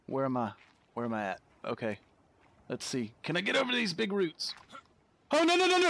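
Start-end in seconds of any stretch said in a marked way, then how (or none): 5.3-5.9 high, loud panicked cry; real alarm at slipping. Real, not acted. Player about to fall off roots, panicked cry.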